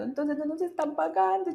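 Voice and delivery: high teary mock wail from her friend; a retold sob in the friend's voice; imitating crying friend. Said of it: "mi amiga era llorando".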